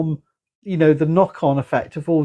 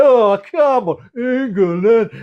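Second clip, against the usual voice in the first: mock England fan; 6 semitones higher, much slower than usual. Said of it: "I was just sitting there going".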